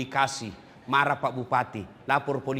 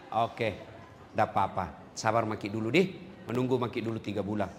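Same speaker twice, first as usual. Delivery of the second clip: lenient regent; 7 dB quieter than usual. Retold or imitated. The regent answering kindly.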